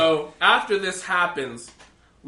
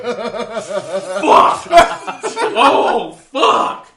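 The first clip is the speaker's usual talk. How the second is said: loud, high panicked fuck; panicked curse; 5 semitones higher, 10 dB louder, much slower than usual. Retold or imitated. Boy caught by his crying mom yells in loud panic. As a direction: yelp it out, busted.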